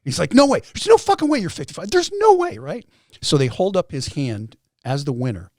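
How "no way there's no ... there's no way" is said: high, loud imitated astonishment; imitating a stunned opponent; loud disbelief. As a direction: exclaim in utter disbelief, voice shooting up. Opponent's disbelief at his age.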